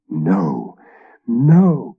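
a horrified knight recoiling; gasped. Acted. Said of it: "gasped the fifth knight".

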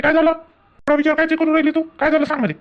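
urgent coaxing, what happened; worried asking. Acted. Husband anxiously asks wife. (काळजीने विचारणं, उंच आवाजात)

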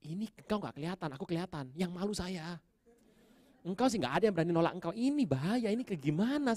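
high-pitched re-enacted protest to God; exasperated complaint. Unready preacher complaining to God, exasperated. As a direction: plead your case, building in pitch and urgency.

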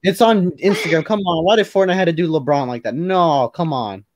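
high-pitched, exasperated disbelief; dismayed protest. Real, not acted. Friend upset at what Fortnite did to LeBron, dismayed protest.

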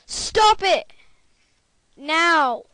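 loud, high, furious yell at a brother; shouting at Luke to stop while recording; angry shout. Real, not acted. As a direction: bellow it so he finally stops.

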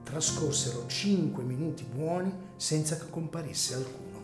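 normal talking voice

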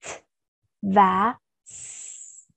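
"t" and "s" sounded one by one; sons finais isolados. Shown as a demonstration. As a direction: isolate the final sounds "t", then "s". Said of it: "chúng ta sẽ có hai âm cuối".

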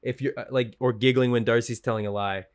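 ordinary talk

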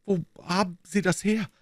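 a broken man groaning the question. A narrator's reading. Shattered suspect seeing the bloody notebook, strained groan. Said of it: "stöhnte er".

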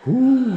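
loud high whoo of triumph; cheering whoop. Real, not acted. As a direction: whoop like a coach celebrating. Cheer after last sprint.

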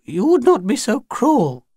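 put-on high, appealing old lady; old-ladyish Grinny. A narrator's reading. Grinny begs the children not to be cruel, high and old-ladyish. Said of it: "so ordinary and old ladyish and unstrained".

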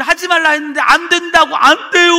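high, loud retold outcry; loud protest. Boy refusing the evangelist's order, loud imitated protest.

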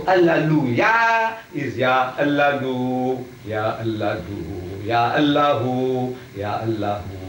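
ya drawn out, then Allahu with a long final vowel; chant-like, slow ya Allahu, each part stretched; slow demonstration. Shown as a demonstration. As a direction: stretch ya Allahu so the shifted ya is heard. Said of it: "We begin with ya".

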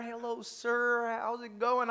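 high, drawn-out, over-friendly greeting; nice church guy. Retold or imitated. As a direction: greet him as the too-nice man from church. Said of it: "I'm the nice guy from the church".

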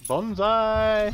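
high, loud, stretched-out mock charge cry; battle cry. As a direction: yell it like a playful kamikaze charge. Yelling battle cry in game.

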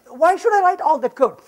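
ordinary talk

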